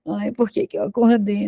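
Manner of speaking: a lightly put-on sulky moan; mock whiny waking. Mocking depressed waking. (lamento de quem acorda, levemente imitado)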